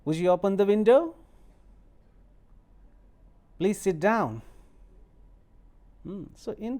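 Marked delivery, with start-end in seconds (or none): 0.1-4.4 polite request said higher and louder, rising. Shown as a demonstration. Teacher demonstrating polite requests with rising tone. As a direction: ask nicely with a lifting tune. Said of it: "you have to use rising tone".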